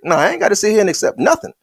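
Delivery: ordinary talk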